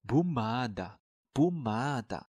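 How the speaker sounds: pomada said slowly, every syllable clear, twice; slow model pronunciation. Shown as a demonstration. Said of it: "用普语该说成". (慢速示范读pomada)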